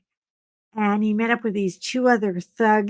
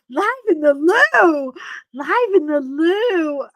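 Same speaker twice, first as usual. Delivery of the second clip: slower, drawn-out laughing repeat; slow laughing echo; 7 semitones higher, 5 dB louder, slower than usual. Retold or imitated. Repeating the friend's loo idea, slowly and laughing. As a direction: savour the words, still giggling.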